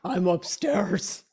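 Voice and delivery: slow mock deep tough voice; slow deep boast; extremely deep bass voice. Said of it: "In the deepest voice you could find".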